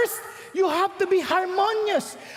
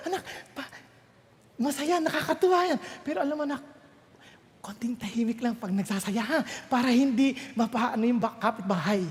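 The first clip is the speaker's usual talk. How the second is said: much softer and lower, tender; gentle parent; 7 semitones lower, 5 dB quieter than usual. Retold or imitated. Parent gently asking child to be quieter, soft. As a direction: hush the child kindly.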